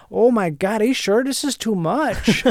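a lightly voiced out-of-work welder from Wisconsin. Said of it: "he's like".

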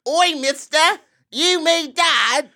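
high, loud British squawk, last words drawn out; exaggerated British accent. Host answering the critique, loud drawn-out British accent. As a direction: blare it slowly in broad British. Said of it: "How is that different?".

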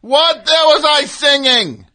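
slow, loud and fed up; loud exasperated shout. Real, not acted. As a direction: bellow the question in disbelief. Host demands what song played.

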